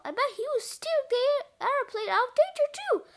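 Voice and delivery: high-pitched eager guess; lifted, enthusiastic storybook voice; excited realization. A narrator's reading. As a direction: say it with rising delight. Character excited by hero's help.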